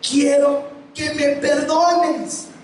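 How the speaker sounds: voz de niño, aguda y muy lenta; súplica lenta de niño arrepentido; hijo pidiendo perdón. Retold or imitated. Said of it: "Y me dice". (hijo pide perdón al papá con voz aguda)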